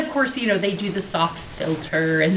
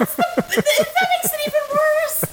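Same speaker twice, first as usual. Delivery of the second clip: laughing. Real, not acted. Laughing at the war remark.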